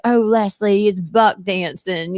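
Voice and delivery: slow imitated Southern belle accent; Mary's Southern drawl. Friend explaining buck dancing to Leslie, Southern drawl. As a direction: draw the words out, sweetly Southern. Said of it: "She talks like this".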